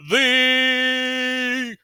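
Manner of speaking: slow, stretched fighter introduction; wrestling ring announcer, hyped. Acted. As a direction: build to a high, loud, endlessly held name.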